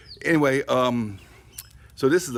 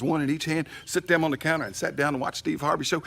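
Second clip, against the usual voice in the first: usual speaking voice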